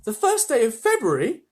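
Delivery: high, loud startled cry; cried out. Acted. Mother crying out at the ticket's date, high and loud. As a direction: Mrs Bucket gasping at the date. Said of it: "cried Missus Bucket".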